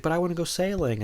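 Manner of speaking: mock whine; whiny kid brother. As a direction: beg like a little brother left out. Little brother whining to Mike.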